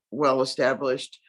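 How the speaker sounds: ordinary talk, nothing marked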